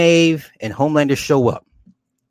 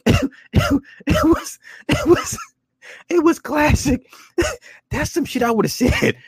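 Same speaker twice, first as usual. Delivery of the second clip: helpless high-pitched laughing speech; cracking up, voice way up high; laughing while talking. Real, not acted. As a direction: squeeze the words out through laughter. Cracks up at quoted line.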